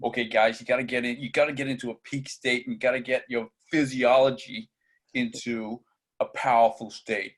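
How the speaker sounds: put-on a lightly done American accent, motivational pep-talk push; a playful American accent firing up a crowd as a self-help guru; Anthony Robbins American accent. Host imitating a self-help guru rallying guys, mild American accent. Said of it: "I'll do my best American accent".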